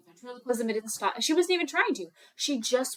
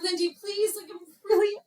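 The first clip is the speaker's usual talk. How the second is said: high, frightened begging, acted out; mock crying plea; 9 semitones higher than usual. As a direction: beg your sister as if close to tears. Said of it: "she's, like, crying".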